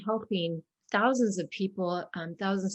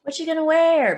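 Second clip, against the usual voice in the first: higher and louder, excited schoolgirl; excited kid; 9 semitones higher, 9 dB louder than usual. Acted. Girl excitedly asking a friend about the party outfit. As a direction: burst out eagerly like a giddy kid.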